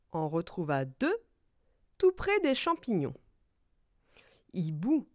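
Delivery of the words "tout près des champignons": usual speaking voice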